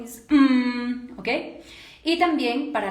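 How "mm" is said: loud hum pushed from the abdomen; punched-belly hum. Shown as a demonstration. Coach showing the right exercise, forceful belly-driven hum. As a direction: hum as if hit in the stomach. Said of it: "como si te dieran un golpe en el abdomen".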